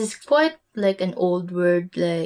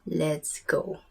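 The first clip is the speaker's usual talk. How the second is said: whisper; 3 semitones lower, 7 dB quieter than usual. Acted. Whispered start of the lesson.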